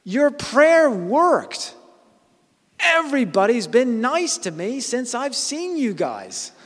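high, loud, slow call with a laugh; retold shout. Lady shouting from her car that prayer worked, joyful shout. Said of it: "Shouts out of the car".

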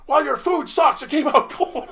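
high, loud mock outburst; pissed-off customer. Caught-out patron turning on the chef, pissed off. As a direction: imitate an angry diner yelling a complaint. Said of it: "now he's pissed off".

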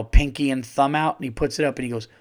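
ordinary talk

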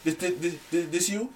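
high, fast taunting gamer voice; mocking gamer voice. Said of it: "He's like".

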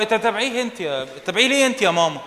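ordinary talk, nothing marked

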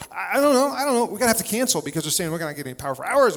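high, loud flustered panic; mock panic, words tumbling fast; panicked. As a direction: blurt it out in a fluster. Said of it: "and I start freaking out".

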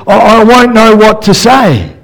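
high, pleading excuse voice; whiny Moses. Retold or imitated. Moses whining his excuse to God. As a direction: plead like a nervous man. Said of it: "And then Moses says".

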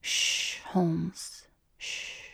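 hushing softly into an ear; soft soothing shush; soothing whisper. A narrator's reading. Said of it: "he was soothing into my ear".

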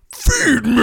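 high, loud sudden feed me; plant monster voice. Pretend. Ambushing the kid outside as the plant, high and loud. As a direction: sneak up and spring it on him. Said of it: "I come up".

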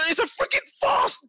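shouted high and strained, slowed down; furious outburst. Real, not acted. Pundit yelling at his co-host over refereeing, furious shout. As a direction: explode at the ref's farce. Heated football row, exploding.

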